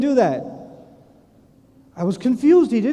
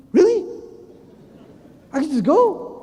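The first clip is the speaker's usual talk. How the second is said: acted-out amazed "can this be true?"; incredulous surprise; 7 semitones higher than usual. Traveller waved through by security, astonished.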